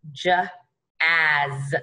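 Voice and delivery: "J, as": j alone, pause, then rime; sounded out. Shown as a demonstration. Teacher reviews jazz with one z, sounded out. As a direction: split "J, as" before blending. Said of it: "only going to make one of those letter".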